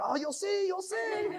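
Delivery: high, laughing, teasing "you'll see"; giddy laughing promise to his wife; excited. Retold or imitated. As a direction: tease her with giddy excitement. Teasing wife after excited yell.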